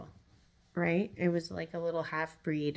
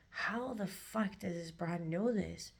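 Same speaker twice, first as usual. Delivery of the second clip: slow, hushed imitation of a stunned man; retold stunned disbelief; 7 dB quieter, slower than usual. Cheating man staring at his partner, imitated stunned disbelief. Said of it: "like they saw a ghost".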